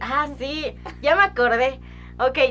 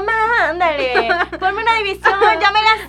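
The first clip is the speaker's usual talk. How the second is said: begging; 9 semitones higher, 8 dB louder than usual. Retold or imitated. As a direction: draw out the plea like a proud kid. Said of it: "Y yo". (suplicando más alto y lento)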